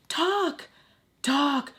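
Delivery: loud, high, desperate urging; re-enacted frantic yell; screaming inside. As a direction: shout at yourself to get the words out. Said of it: "I'm screaming".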